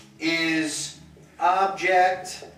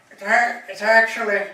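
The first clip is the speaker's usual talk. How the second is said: higher, louder mock correction, with a laugh; mock know-it-all correction; 5 semitones higher, 6 dB louder than usual. Teacher mocking a pedantic roommate, higher and louder. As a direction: play the smug grammar corrector. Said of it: "whoever's in the room will be like".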